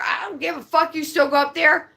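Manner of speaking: ordinary talk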